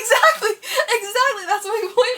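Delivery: loud, high, laughing insistence; heated insistence. Real, not acted. Winning a point in a squabble, heated insistence. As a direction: drive the point home at full voice. Heated argument, talking over each other.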